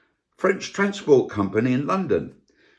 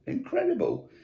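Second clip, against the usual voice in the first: incredible swooping much higher in disbelief; incredulous; 4 dB quieter than usual. Shown as a demonstration. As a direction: let incredible leap up in amazement.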